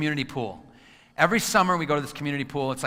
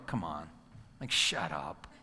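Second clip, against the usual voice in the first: lower, softer dismissive grumble; scoffing inner voice; 8 semitones lower, 8 dB quieter, slower than usual. Shown as a demonstration. Brain scoffing at fake positivity, low dismissive grumble. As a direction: brush off the fake cheer. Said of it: "your brain's gonna go".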